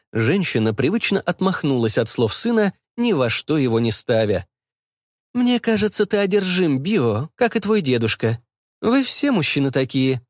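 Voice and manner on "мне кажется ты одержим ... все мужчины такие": lightly voiced mother, starting high; reproachful mother, pitch settling as she goes; nagging mother. Mother accusing her son of obsession, nagging.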